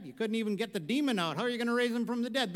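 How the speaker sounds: ordinary talk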